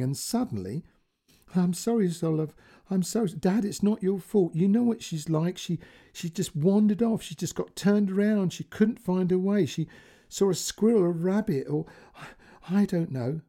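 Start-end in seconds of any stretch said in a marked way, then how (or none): 1.5-3.3 a touch of tearful apology; mildly voiced crying father. A narrator's reading. Grandfather sobs apology to daughter over phone, mildly tearful. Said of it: "her dad was crying". 3.3-13.4 crying. A narrator's reading. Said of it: "Claire was crying now".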